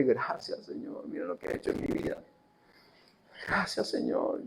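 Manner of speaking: hushed, breathy prayer of gratitude; the healed woman's whispered thanks. Acted. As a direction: whisper your thanks to the Lord. Intimate thanks to the Lord.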